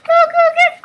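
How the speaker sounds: very high, very loud squeal; piercing mock-excited outburst; excited shriek. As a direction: shriek it over the top. Repeats the surprise, overdone.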